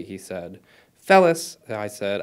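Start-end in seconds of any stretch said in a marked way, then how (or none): 1.0-1.6 urgent call. A narrator's reading. Urging a stuck friend.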